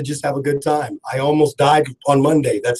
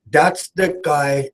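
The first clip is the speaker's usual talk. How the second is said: slow, drawn-out West African accent impression; Yofi accent; much slower than usual. Host imitates his friend praising him, slow West African accent. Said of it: "I'm trying to do it like he says it".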